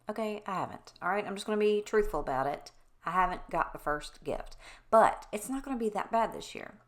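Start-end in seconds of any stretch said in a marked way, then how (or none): none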